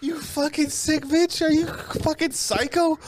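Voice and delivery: high-pitched, incredulous mock tirade; hammed-up horrified scolding; mock outrage. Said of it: "I was like".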